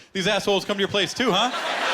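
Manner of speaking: high-pitched impression of mom; imitated clueless mom. Mom to her Jehovah's Witness friends, imitated high-voiced blurt. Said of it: "my mom didn't know they were Jehovah's".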